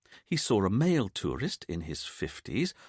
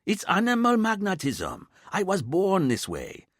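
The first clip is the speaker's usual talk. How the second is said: much higher, louder cocky voice; boastful Dwayne; 11 semitones higher, 4 dB louder than usual. A narrator's reading. Officer bragging to his chief about women, cocky. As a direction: brag like a ladies' man.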